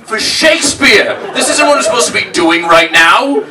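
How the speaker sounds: high, loud ranting meltdown shout; narrator's furious breakdown. Retold or imitated. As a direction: yell it like a narrator who's lost it. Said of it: "He's like".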